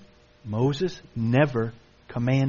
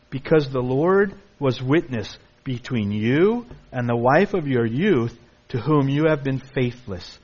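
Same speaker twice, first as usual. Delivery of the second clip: normal talking voice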